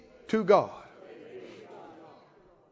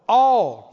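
"all" hit hard and loud; emphasizing all; 6 dB louder than usual. Acted. Preacher stresses "all" to church, loud. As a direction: land "all" with full force. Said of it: "now he keeps emphasizing".

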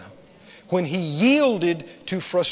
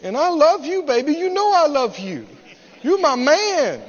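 higher, cloying plea to her man; mock crying woman; 6 semitones higher than usual. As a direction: imitate a lover laying it on thick. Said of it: "She's crying".

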